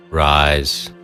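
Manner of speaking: usual speaking voice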